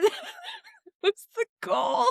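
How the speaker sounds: laughing, high and slow. Real, not acted. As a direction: say it through a big laugh. Laughing at herself.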